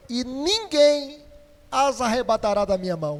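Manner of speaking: preacher modelling the line for the church to repeat; stress on ninguém. Shown as a demonstration. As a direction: drag out "ninguém" to lead the congregation. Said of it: "Vamos dizer isso comigo?".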